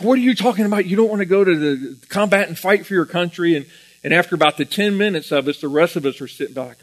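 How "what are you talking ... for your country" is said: raised, louder yell right in his face; drill instructor yelling. Retold or imitated. Captain yelling at the recruit who won't fight, loud and raised. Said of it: "yelling at him".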